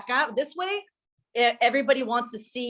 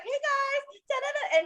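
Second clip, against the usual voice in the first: much higher, chirpy "hey guys" voice; bubbly influencer impression; 13 semitones higher, 5 dB quieter, slower than usual. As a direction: play her chirpy, much higher camera greeting. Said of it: "She's like".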